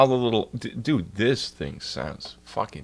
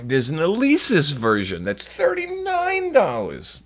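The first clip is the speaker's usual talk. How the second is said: incredulous complaint; 7 semitones higher, 4 dB louder, slower than usual. Real, not acted. Outraged at the price.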